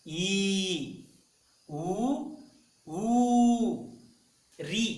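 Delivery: each vowel drawn out slowly and clearly, one by one; careful vowel demo. Shown as a demonstration. Teacher modelling Hindi vowels slowly for students. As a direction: model every vowel for pupils to copy. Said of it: "इनका सही उच्चारण करना बहुत ही जरूरी होता".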